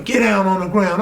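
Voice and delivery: high, moderate mock yell; retold police yell. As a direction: mimic officers yelling, without full volume. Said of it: "And they yelling".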